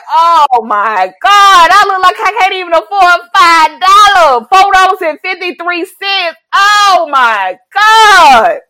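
high, loud, slow, mortified; loud embarrassed lament; loud mortified wail. Retold or imitated. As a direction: wail in shame. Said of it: "it was just like".

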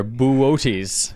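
"Buotes" as boo-OH-teez, three syllables; correct pronunciation. Shown as a demonstration. Host educating co-host, "Buotes" carefully pronounced boo-OH-teez. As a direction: sound out "Buotes" with a stressed middle OH. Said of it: "there's your education there".

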